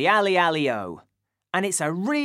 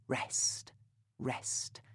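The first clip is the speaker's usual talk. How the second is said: whispered rest, barely voiced; 8 semitones lower, 16 dB quieter than usual. Shown as a demonstration. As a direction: breathe the word out softly. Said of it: "rests are gaps or pauses".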